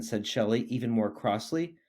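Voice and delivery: usual speaking voice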